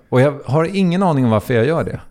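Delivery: ordinary talk, nothing marked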